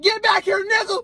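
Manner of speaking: mock angry yell. Girlfriend chasing him to car.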